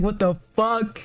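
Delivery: high, slowed-down shocked outburst with a laugh; shocked disbelief. Retold or imitated. Jaw dropped at the scene.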